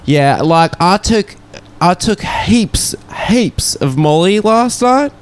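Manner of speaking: put-on high drawn-out Aussie party girl voice; mock Australian accent, slow and high. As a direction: drawl it like a hungover Aussie girl. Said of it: "one of them was like".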